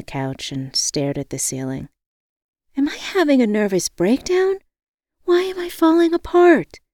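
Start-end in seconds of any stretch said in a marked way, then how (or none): 2.8-6.8 high, building to a loud cry; desperate questions, pitched way up; distraught. A narrator's reading. Woman staring at the ceiling questions herself, distraught. Narrator acts her breakdown.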